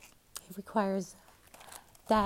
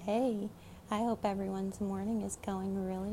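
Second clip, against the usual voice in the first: ordinary delivery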